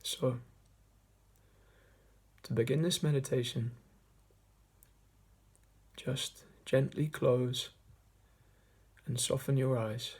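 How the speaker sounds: measured meditation voice with long pauses; guided meditation. Acted. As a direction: guide listeners gently inward, leaving long silences between phrases. Leads a meditation.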